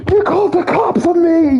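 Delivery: high furious shout; angry yell. Retold or imitated. To the salesman at his door, a high angry yell. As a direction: scream it at the door. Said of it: "he's like".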